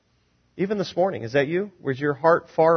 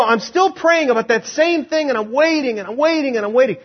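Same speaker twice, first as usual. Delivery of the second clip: high-pitched, repetitive lament; exasperated complaint; 8 semitones higher than usual. Acted. Preacher voicing someone still praying and waiting, exasperated. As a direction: pile up each wait in a high, fed-up voice. Acts out weary repeated praying.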